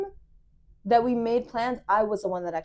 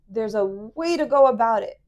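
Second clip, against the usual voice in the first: ordinary talk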